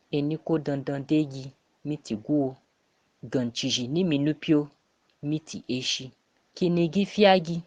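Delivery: usual speaking voice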